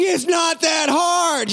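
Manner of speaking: high, drawn-out shout; hollering. Retold or imitated. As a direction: call it out slowly, hammering each word. Dad hollering to child overhead.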